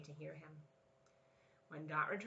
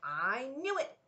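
cried out; 6 semitones higher, 6 dB louder than usual. A narrator's reading. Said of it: "cried Dot".

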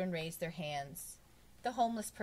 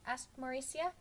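usual speaking voice

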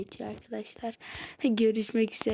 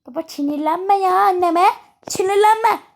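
loud, higher yell at dad; child shouting; 6 semitones higher, 11 dB louder than usual. Pretend. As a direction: shout to stop the fight. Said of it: "bağırma sen". (babasına bağıran kız, yüksek ve tiz sesle)

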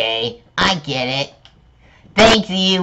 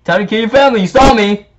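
mock villain threat; 10 semitones higher, 5 dB louder, much faster than usual.

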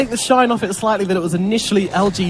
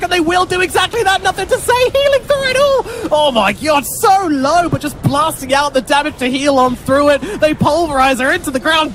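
excited; 8 semitones higher than usual. Real, not acted. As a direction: build to the kill like a caster. Climactic fight call.

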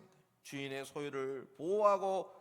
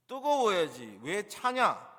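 high, loud, drawn-out challenge; emphatic sarcastic scolding; ironic rebuke; 4 semitones higher, 8 dB louder, slower than usual. Acted. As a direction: demand it pointedly, dragging the words. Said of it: "이런 반어적인 표현입니다".

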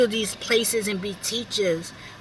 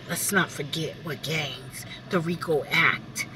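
hushed pointed whisper; 7 semitones lower, 6 dB quieter than usual. Real, not acted. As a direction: whisper it like a secret. A pointed aside.

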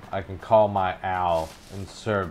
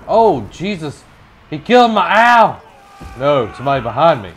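high, loud trash-talk shouting; excited yelling; 7 semitones higher, 10 dB louder than usual. Real, not acted. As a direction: yell it hyped mid-fight. Fight in game, gamer yelling.

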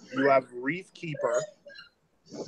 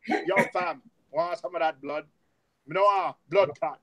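mock Jamaican; 2 semitones higher, 4 dB louder than usual. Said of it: "it's more Jamaican".

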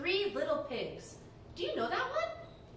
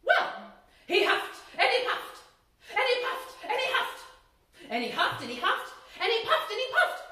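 higher, louder, straining narration; breathless storyteller climax; building excitement; 7 semitones higher, 5 dB louder than usual. A narrator's reading. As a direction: pump it up with each huff and puff.

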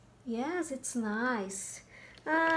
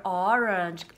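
normal talking voice

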